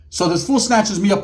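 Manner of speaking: usual speaking voice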